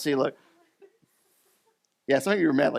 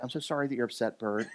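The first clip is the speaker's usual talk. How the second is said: chatbot voice; 3 semitones lower, 9 dB quieter than usual. Retold or imitated. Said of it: "the A I bot's like".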